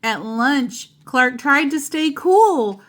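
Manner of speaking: plain reading voice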